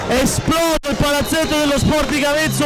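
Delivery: high, slow, excited tribute; breathless italian goal commentary. Real, not acted. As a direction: hail the scorer like a showman. Co-commentator hails the goal scorer.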